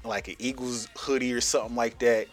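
normal talking voice

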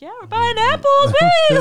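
high, loud cheer; overly excited woo; mock excited. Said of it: "I'm like".

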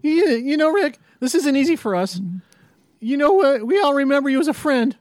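a hint of panicky Morty holding a gun. To Rick at gunpoint, a light Morty voice.

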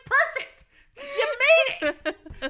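high, loud, laughing put-on voice; mock proud reply. Son retells his answer to mom, high and laughing. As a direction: answer her in a squeaky laughing voice. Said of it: "I'm like".

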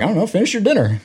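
lightly voiced grandparent; offhand grandparent. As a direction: brush off the kid lightly. Grandparents answer the child.

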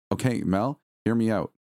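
usual speaking voice

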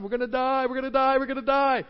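mock panic. Israelites facing the army, in a light mock panic. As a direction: act out the frightened Israelites, lightly. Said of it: "they're all hollering and carrying on".

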